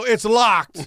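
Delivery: mock triumphant shout, pitched high; loud announcement. Actor retelling his exit line to the crew, loud proclamation.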